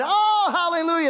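a high, loud cry of praise; hallelujah. Real, not acted. Preacher rejoicing mid-sermon, high and loud hallelujah.

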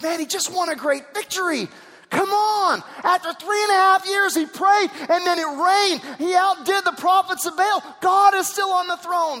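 high, loud preacher's shout; full-force preaching. Acted. Preacher shouting Elijah's victory to the church, full force.

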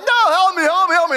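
loud, high cries for help; mock drowning panic. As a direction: act out a flailing swimmer pleading. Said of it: "and we're like".

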